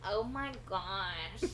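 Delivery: put-on slow drawn-out valley girl voice; valley girl imitation. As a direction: mimic her valley girl drawl. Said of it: "That's what you sound like".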